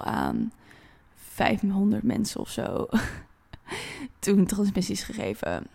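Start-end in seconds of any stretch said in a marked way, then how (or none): 3.5-4.1 light laugh. Real, not acted. Laughs about the big number.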